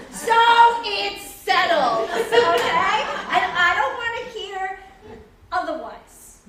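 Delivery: loud and slow, laying down the law; a loud, final "it's settled"; firm insistence. Acted. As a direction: settle it loudly, no arguments. Insisting over their objections.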